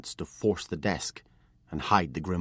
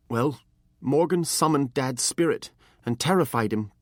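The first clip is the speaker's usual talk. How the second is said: put-on higher, earnest young apprentice; pleading boy; 7 semitones higher, 5 dB louder than usual. A narrator's reading. Apprentice explains the betrayal to his master, higher and pleading. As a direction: beg to be understood.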